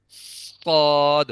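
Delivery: صاد drawn out slowly, tongue root on palate; a long, thick, full صاد; heavy suad. Shown as a demonstration. Teacher demonstrates the full صاد slowly. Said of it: "بالکل اوپر تالو کے ساتھ ملا دیں گے".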